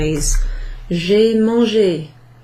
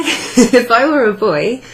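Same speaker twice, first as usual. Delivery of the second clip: higher, louder, through a laugh; amused laughing; 4 semitones higher, 6 dB louder than usual. Real, not acted. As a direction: laugh at your own singing as you speak. Laughs at her own singing.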